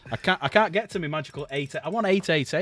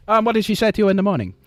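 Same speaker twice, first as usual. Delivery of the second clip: loud, quick Italian questioning; Italian accent; 8 semitones higher, 10 dB louder, faster than usual. Acted. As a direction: press him in a comic Italian accent. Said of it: "the bloody accents you've just done".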